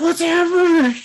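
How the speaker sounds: high, loud squeal of bewilderment; hammed-up freak-out at an absurd offer; mock panic. Retold character baffled by chess invitation.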